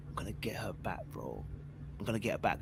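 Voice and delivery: breathy private whisper to himself. Retold or imitated. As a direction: whisper it like a secret vow. Inner vow to win her back.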